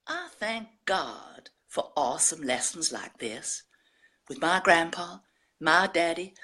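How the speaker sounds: Southern American drawl. Acted. Woman reading her poem of fishing with her son, Southern American drawl. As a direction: read it as a sweet, unhurried Southern American storyteller. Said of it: "in this Southern American drawl".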